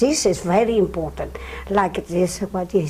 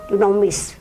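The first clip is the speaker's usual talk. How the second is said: ordinary delivery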